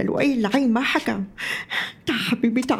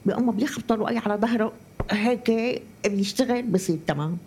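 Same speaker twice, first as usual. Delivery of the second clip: ordinary delivery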